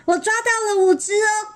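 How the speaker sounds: high, delighted storybook voice; happy boast. Acted. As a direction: show off the catch gleefully. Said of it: "贝尔也高兴地说".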